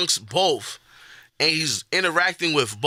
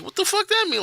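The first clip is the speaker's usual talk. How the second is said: much higher, incredulous outburst; baffled retort. Retold or imitated. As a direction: throw it up high in disbelief. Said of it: "And I'm like".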